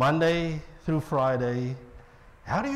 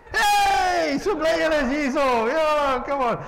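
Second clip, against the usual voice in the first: enthusiastic greeting; 11 semitones higher than usual. Retold or imitated. Colleague John greeting a delivery man, much higher and overjoyed. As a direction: burst in like an overeager host. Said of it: "he's always enthusiastic".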